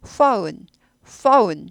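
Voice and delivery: "phone" said slowly with a full "ou" glide; oh-oo vowel. Shown as a demonstration. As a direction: stretch "phone" into "foun". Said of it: "O 아니고 오우 발음이에요".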